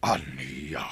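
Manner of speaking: shocked whisper. Acted. Shocked captive reacts.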